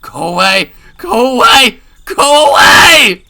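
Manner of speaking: builds from loud to a very high scream; escalating scream. Acted. Keiichi pulling the door on Rena, escalating scream. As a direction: scream harder each time.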